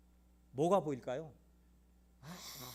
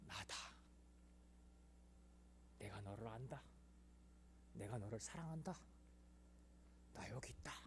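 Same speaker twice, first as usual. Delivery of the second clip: low, soft, hushed divine voice; God comforting the weeping son; 6 semitones lower, 17 dB quieter than usual. Retold or imitated. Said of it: "음성이 들린 거예요".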